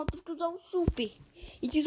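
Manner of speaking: quick whispered aside; whispered apology. Real, not acted. Gamer apologising to teammate for using the super, whispered.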